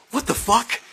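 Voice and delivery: high, loud startled cry; stoned shock. Retold or imitated. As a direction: blurt it in stunned alarm. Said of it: "I'm like".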